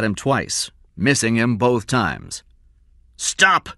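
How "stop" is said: higher sharp shout. A narrator's reading. Big man shouting at fleeing Cy. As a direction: call after the runner. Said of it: "the man shouted".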